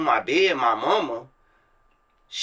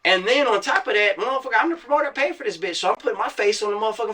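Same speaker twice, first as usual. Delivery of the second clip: excited boast; 8 semitones higher, faster than usual. Real, not acted. Bragging about his own flyer.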